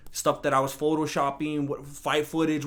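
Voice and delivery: normal talking voice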